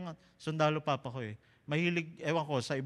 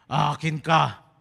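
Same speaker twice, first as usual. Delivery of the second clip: slow demon voice; 2 semitones lower, 7 dB louder, much slower than usual. Shown as a demonstration. Comic showing the crowd the demon's slow claim. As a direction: play the demon claiming a child, slowly. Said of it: "Let me try".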